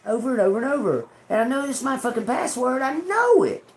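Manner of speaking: real annoyance building; frustrated rant. Real, not acted. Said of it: "that pissed me off then".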